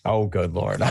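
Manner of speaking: normal talking voice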